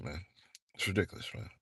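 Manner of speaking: ordinary talk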